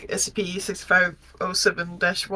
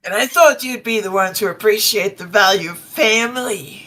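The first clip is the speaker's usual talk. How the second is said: put-on Texan accent; 7 dB louder than usual. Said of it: "strong Texan accent".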